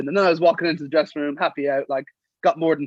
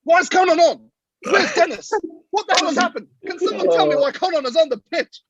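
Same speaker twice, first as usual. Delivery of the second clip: high-pitched, louder mock-frantic coach; Pat Lam; 13 semitones higher, 4 dB louder than usual. Coach on the mic from the stand, mock exasperated. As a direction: demand answers in baffled exasperation. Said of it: "on the mic to everyone being like".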